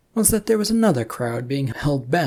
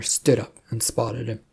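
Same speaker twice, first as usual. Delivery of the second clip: plain reading voice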